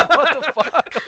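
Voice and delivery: high, loud startled what the fuck; shocked outburst. Acted. Hawthorne reacting to the flask being thrown, startled shout. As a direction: blurt it out in total shock. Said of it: "Hawthorne's gonna, like, look at that and be like".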